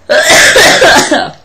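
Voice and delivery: loud hacking cough; harsh coughing fit. Real, not acted.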